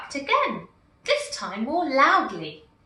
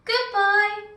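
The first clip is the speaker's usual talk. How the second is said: high, louder farewell call; calling goodbye; 11 semitones higher, 6 dB louder than usual. A narrator's reading. Gumboot calling goodbye to the little girl, cheerful call. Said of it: "called Gumboot to the little girl".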